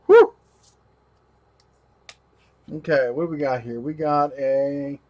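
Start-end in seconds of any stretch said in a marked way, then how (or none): 0.1-0.3 loud high blowing whew; blowing dust away. Real, not acted. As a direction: blow the dust off hard, whew. Everyone hold your breath.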